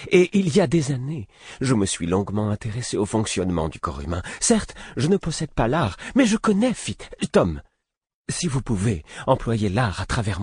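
high, pressing voice; insistent. A narrator's reading. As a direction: insist on being allowed to help. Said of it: "d'un ton insistant".

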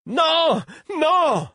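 very high anguished screams; heart-rending howl. A narrator's reading. Prince watches the fight in horror, heart-rending high howl. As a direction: howl it out as if torn apart. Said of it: "hurler d'un ton déchirant".

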